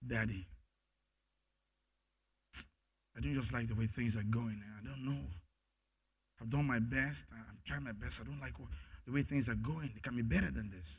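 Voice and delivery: soft, low, weary prayer to Daddy; hushed honest plea; intimate prayer. Acted. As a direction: pour out frustration gently to a father. Believer praying to God as Daddy.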